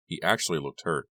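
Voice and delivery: usual speaking voice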